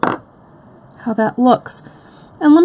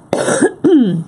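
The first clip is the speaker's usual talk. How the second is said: hard single cough; real cough mid-sentence; coughing fit; 4 semitones higher than usual. Real, not acted. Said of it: "Someday I'll stop coughing".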